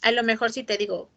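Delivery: ordinary talk, nothing marked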